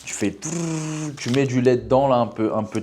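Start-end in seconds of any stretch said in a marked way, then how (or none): 0.3-1.2 whirring noise. Retold or imitated. Imitating mixer whirring sound.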